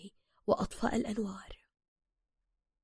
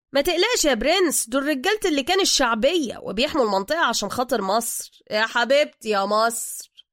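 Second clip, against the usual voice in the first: reassuring driver; 4 semitones higher, 6 dB louder than usual. Acted. Driver praises the men guarding Egypt, loudly effusive. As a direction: gush loudly, slowing into patriotic devotion.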